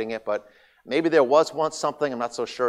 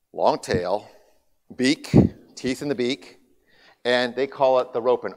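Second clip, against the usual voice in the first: ordinary delivery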